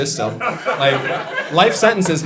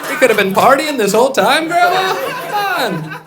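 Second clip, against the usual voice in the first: mock excited; 11 semitones higher, 6 dB louder than usual. Mock pleading with grandma.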